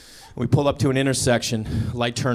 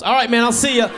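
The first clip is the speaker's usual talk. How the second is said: hasty loud goodbye; 7 semitones higher, 5 dB louder than usual. Retold or imitated. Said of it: "I'm like".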